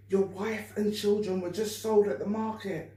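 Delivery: ordinary delivery, nothing marked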